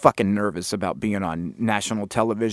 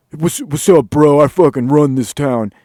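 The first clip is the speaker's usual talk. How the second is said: lightly voiced cocky club dude; mock bottle-service bro; 4 dB louder than usual. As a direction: put on a hint of LA bro swagger.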